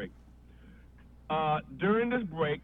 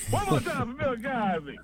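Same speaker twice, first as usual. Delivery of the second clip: announcer; 6 semitones higher than usual. Retold or imitated. Mock announcer call.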